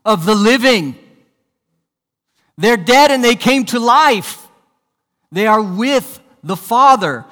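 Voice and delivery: loud, ringing preacher's declaration; emphatic proclamation. Real, not acted. Pastor declaring the dead are alive, loud and emphatic. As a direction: belt out the answer to the church.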